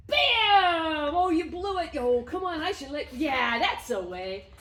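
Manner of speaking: high, loud playful taunts; loud mock-disappointed cheer; excited teasing. Real, not acted. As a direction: tease him when he blows it. Reward game, dog misses.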